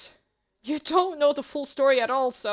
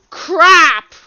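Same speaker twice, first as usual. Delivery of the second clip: loud burst of disbelief; exasperated shout; 4 semitones higher, 14 dB louder than usual. Real, not acted. Player appalled by the game's line, exasperated shout. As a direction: blurt it out at full volume. Player freaking out at game dialogue.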